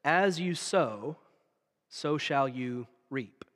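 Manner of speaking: slow, weighty verse, fading to a whisper on reap; hushed slow scripture; slow solemn recitation. Shown as a demonstration. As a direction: recite it like the preacher starting the verse. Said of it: "the whole church will join in".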